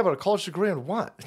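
ordinary talk

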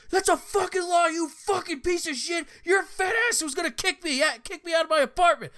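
high, loud enraged screaming; furious yelling neighbor. Retold or imitated. Neighbor screaming at the cops, high loud furious yell. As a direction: scream the accusation at full rage.

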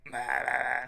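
croaky ruined-throat voice; raspy smoker voice. Retold or imitated. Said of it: "he sounds like a, a smoker".

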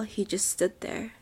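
ordinary delivery

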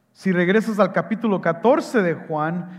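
ordinary talk, nothing marked

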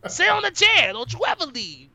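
mock-possessive command to stay put; imitating Bump. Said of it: "You know, in his voice".

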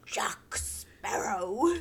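slow whispered attempt at Jack Sparrow, only a hint of the pirate; Jack Sparrow impression. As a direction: hush out a failed pirate captain. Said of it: "I cannot do it".

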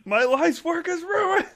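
high, slow wail through laughter; a professor wailing over his ruined life, laughing. Acted. Host laughing while voicing a professor beaten by the janitor.

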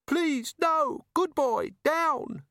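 very high, panicky pleading; frightened plea. A narrator's reading. Trapped robber calming a growling beast, frightened. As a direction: plead in a strained high pitch to hold it off.

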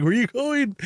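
very high imitated plea; high-pitched mimicry of being left behind; mock distressed calling. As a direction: call after someone in a high, laughing impression.

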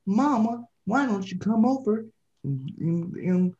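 high, slow mock Southern mama's boy; creepy-soft high-pitched imitation; quiet, high, weird voice. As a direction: put on a weird, high little drawl. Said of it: "quiet, but then it's also, like, high".

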